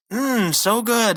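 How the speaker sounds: high, slow, savoring hum; mock relish. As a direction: imitate exaggerated enjoyment of food. Imitates Rigby pretending to relish egg.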